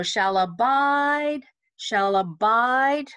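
slow "abide", weight landing on "bide"; emphasis on BIDE. Shown as a demonstration. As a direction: stretch the second syllable of each "abide". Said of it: "emphasize the bide".

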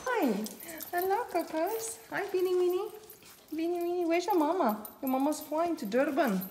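high, soft sing-song pet talk; high cooing voice for the dogs. Real, not acted. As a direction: coo at the puppies like babies.

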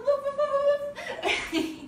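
high woofs tipping into laughter; excited, playful high yapping; happy dog bark. Pretend. Teacher wagging like a happy dog, high laughing barks.

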